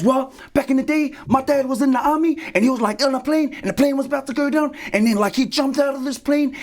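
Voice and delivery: put-on high-pitched little boy telling a tall tale; schoolboy breathlessly recounting dad's exploits; Little Johnny. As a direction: keep a kid's voice way up high. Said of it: "okay, little Johnny".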